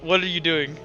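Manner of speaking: usual speaking voice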